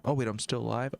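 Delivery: ordinary delivery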